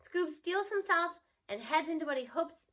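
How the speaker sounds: plain reading voice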